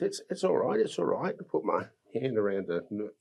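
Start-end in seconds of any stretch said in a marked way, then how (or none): 0.0-1.3 put-on high, gentle soothing, like calming a child; soothing reassurance. Reaching out to comfort her: high, gentle reassurance. Comforting a frightened little girl.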